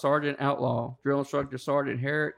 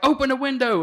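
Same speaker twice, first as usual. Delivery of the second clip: higher mocking instructor command; mock order; 10 semitones higher, 4 dB louder than usual. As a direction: bark the order in a high taunt.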